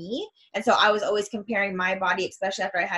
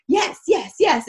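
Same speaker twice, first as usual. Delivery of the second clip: excited; 9 semitones higher, 6 dB louder, slower than usual. Retold or imitated. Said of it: "So I'm like".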